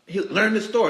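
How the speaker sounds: loud, high mock scolding; gruff dad. Dad telling a customer to learn the store, gruffly. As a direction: imitate the gruff owner, amused. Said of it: "He was gruff in his love".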